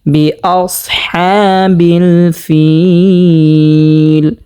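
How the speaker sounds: বিআসহাবিল ফিল chanted very slowly, vowels held long; drawn-out recitation. Shown as a demonstration. Teacher showing viewers the stretched vowel, slow chant. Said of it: "এক আলিফ পরিমাণ টানিয়ে পড়তে হবে".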